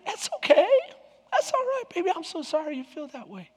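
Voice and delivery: high, hushed mock-tender comforting; hammed-up gentle sympathy, very quiet; soothing. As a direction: coo softly to an upset wife. Husband soothing his upset wife.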